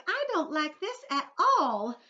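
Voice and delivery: high, slow, worried little voice; nervous ladybug. Acted. Ladybug frets to her friends in a high worried voice. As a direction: fret as the ladybug.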